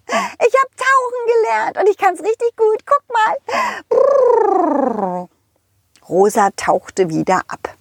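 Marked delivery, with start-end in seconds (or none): 0.1-5.3 squeaky high cartoon horse calling out. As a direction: play a cheerful high-pitched pony. Rosa the horse speaks. (hohe quietschige Pferdestimme)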